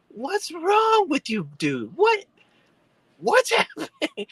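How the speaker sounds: high-pitched, drawn-out mock exclamation; alarmed bewilderment. Driver answering his screaming friend, high bewildered cry. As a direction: act out baffled alarm, voice jumping up.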